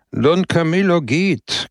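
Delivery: high, slow, savouring the news; drawn-out gleeful proclamation; mock-solemn triumph; triumphant announcement. Pepone announces to party.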